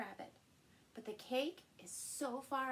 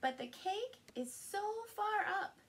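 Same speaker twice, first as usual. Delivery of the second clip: far lifted high, reaching upward; 8 semitones higher than usual. A narrator's reading. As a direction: voice climbs on so far up. Reading picture book to child.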